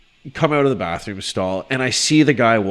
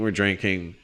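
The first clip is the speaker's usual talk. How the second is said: normal talking voice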